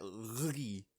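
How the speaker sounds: soft, low "Lee" with a rolled-up palatal L; effortful L opening "Lee"; attempting Italian "gli". Shown as a demonstration. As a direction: quietly fumble the Italian L in "Lee". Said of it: "however you say that".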